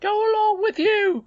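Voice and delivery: very high, loud dismissal; indignant cry. A narrator's reading. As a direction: cry it out to shoo the boy off. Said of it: "cried the housekeeper".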